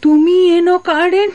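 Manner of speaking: high, stunned cry of disbelief; shocked disbelief. Acted. Wife recognises lost husband, shocked. (হতবাক, উঁচু গলায় বিস্ময়)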